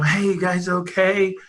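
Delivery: imitated holler across water; retold yell. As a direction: call out slowly without real volume. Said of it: "I'm yelling to them".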